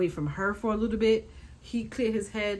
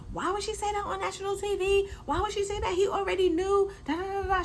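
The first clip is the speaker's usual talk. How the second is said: high, hammed-up shouting mother-in-law; shrill-free screech of an angry mom, played up; mock screaming mom; 12 semitones higher than usual. Vlogger imitating the mom yelling through the door, hammed-up and high.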